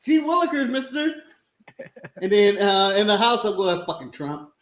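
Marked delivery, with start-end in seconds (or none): 0.0-1.1 put-on high, eager, gee-whiz boy voice; wholesome 1950s kid. Eager sellout parody. 3.8-4.5 low, soft muttered grumble; muttered curse. Pretend. Private grumble behind closed doors.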